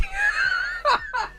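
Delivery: very high, incredulous laughter; high-pitched laugh. Real, not acted. Streamer laughing off chat's accusations, high-pitched laugh. Reacting to mock trial accusations.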